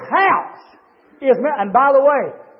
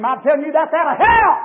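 high, loud, rising sermon cadence; shouted hellfire-and-brimstone delivery; full-force preaching; 8 semitones higher, 5 dB louder than usual. Acted. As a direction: preach it hard to the whole church. Preacher denounces from pulpit.

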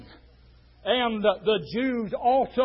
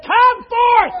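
high, loud pulpit shout; crying out; 14 semitones higher, 13 dB louder than usual. Acted. Preacher tells how the dead man came forth, crying out. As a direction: proclaim it at full voice. Preacher cries out gospel passage.

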